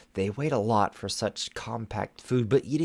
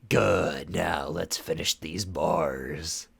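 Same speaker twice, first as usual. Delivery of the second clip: slow menacing drawl; 4 semitones lower, much slower than usual. Acted. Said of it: "Shadow Weaver the rapper".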